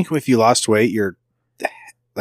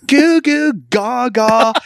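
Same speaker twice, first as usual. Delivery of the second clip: put-on high, slow baby babble; emo baby; 12 semitones higher, 3 dB louder, much slower than usual. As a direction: coo it out like a moody baby. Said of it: "my impression of an emo baby".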